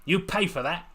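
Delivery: cackled. Acted. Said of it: "she cackled".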